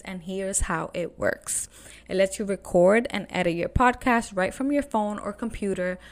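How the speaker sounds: upbeat host ad read with punched openings; friendly podcast sponsor spot. Real, not acted. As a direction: sell it lively to the listeners. Reads a podcast platform ad.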